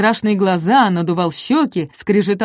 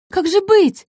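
very high worried question; plead, small and alarmed; fretful little dinosaur; 12 semitones higher than usual. Acted. Ком растерянно, тонким голосом.

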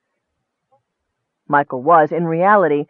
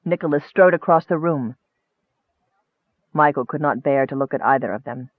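usual speaking voice

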